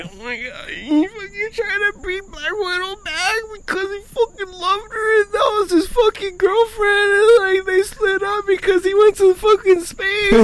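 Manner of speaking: very high, squeaky mock sobbing; crying. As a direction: rant through exaggerated tears. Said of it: "I'm still fucking crying from that part".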